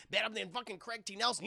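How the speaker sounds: ordinary talk, nothing marked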